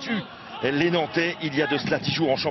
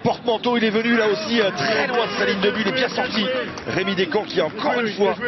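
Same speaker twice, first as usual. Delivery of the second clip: excited sports commentary; 7 semitones higher than usual. Acted. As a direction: build the chance with urgency. Live call of a chance.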